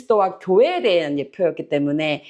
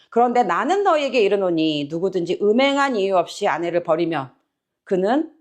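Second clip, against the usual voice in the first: ordinary talk, nothing marked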